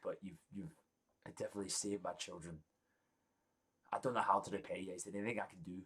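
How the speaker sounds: a lightly voiced king, quiet and earnest; grateful king. King thanking the adventurers after saving his children, softly grateful. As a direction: thank the heroes softly, with real gratitude.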